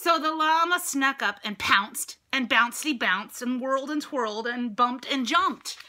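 lively rhythmic read-aloud; playful rhyming storybook reading; bouncy narration. A narrator's reading. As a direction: bounce along with the words. Reads picture book aloud.

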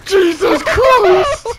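slow, drawn-out loud exclamation; shocked shout. Real, not acted. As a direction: stretch it out in disbelief. Shocked outburst in game.

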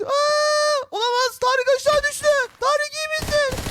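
high, urgent call down to a fallen friend; alarmed call. Acted. Calling down to Tarık after he fell, high and alarmed. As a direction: shout his name, panicked.

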